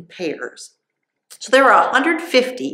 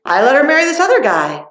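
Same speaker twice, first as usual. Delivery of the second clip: a lightly voiced dad, higher and louder, hurrying his excuses; apologetic father; 9 semitones higher, 6 dB louder, faster than usual. Bride's father excusing himself to Samson, higher, louder, hurried. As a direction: play the sheepish father-in-law offering a swap. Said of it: "And her dad said".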